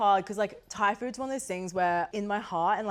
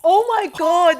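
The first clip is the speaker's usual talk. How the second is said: disgusted shock; 10 semitones higher, 13 dB louder, slower than usual. Real, not acted. Tasting gross food mixture.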